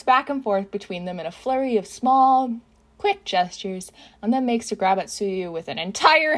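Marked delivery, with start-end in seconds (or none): none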